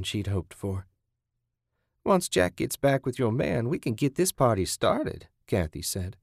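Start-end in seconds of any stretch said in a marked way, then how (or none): 2.0-5.3 high-pitched captor, looking forward; gleeful captor. Acted. Kathy to captive before the party, gleeful. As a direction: announce the grim party with relish.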